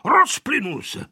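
high-pitched scolding; cross grandpa. A narrator's reading. Grandpa scolding children for getting lost, angry. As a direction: exclaim indignantly, as a grandpa telling children off. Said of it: "zlobil se dědeček nahlas".

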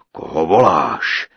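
low, slow, loud giant voice; booming giant. A narrator's reading. Giant greeting his captive bride, low slow booming. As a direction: thunder it out like a huge giant.

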